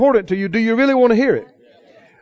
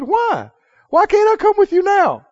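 higher-pitched, insistent questioning; pleading protest; 10 semitones higher than usual. Retold or imitated. Peter asks the Lord why he can't come, insistent protest. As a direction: press the question, pitch climbing at the end.

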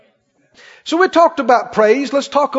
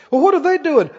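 usual speaking voice

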